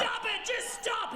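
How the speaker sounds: very high, urgent shout; mock desperate yell. Buyer shouting at man running for car, desperate. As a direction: yell after him to halt, exasperated.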